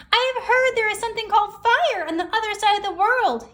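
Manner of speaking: a bold bird calling everyone together; Raven's voice. Acted. Raven tells the gathering about fire, high and bold. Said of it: "he said".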